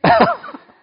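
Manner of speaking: loud, high laughing outburst; big burst of laughter. Real, not acted. Speaker bursting into loud laughter at the joke.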